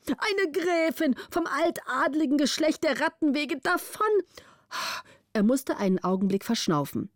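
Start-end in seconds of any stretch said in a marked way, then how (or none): 0.1-4.1 hohe, empörte Vormundsstimme; aufgebrachter Vormund. A narrator's reading. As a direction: ein empörter alter Vormund, höher gesprochen. Vormund voiced by narrator. (aufgebrachter Vormund, hohe Stimme)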